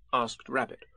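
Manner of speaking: ordinary delivery, nothing marked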